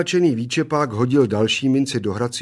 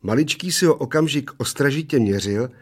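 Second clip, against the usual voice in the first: ordinary delivery, nothing marked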